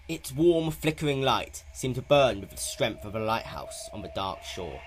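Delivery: ordinary delivery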